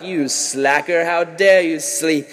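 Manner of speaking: slow, drawn-out pompous telling-off; hammed-up disapproval, stretched words; mock scolding. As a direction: scold with exaggerated slow outrage. Mock divine scolding of a sleeper.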